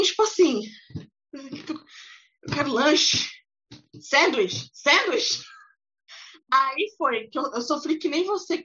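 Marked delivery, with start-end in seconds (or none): 1.3-5.4 put-on halting, slow American-accented Portuguese; imitating a gringo. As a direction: play a lost American gringo struggling in Portuguese. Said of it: "E o gringo olhava pra mim". (imitando gringo com sotaque americano, hesitante)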